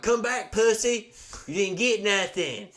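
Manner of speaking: loud, high drawn-out taunting yell. Retold or imitated. Yelling at a fleeing car prowler around the cabins, loud taunting holler. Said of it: "So I start yelling".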